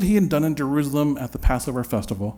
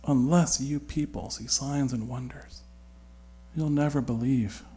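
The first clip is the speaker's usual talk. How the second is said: softer, disappointed murmur to himself; talking to himself; 6 dB quieter than usual. Acted. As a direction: say it quietly, almost to yourself, let down. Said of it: "almost like he's talking to himself".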